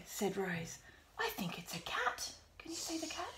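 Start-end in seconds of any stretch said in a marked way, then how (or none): 1.2-3.3 hushed whisper to the dog; breathy secret whisper. Acted. Reading story to child, Rose whispers.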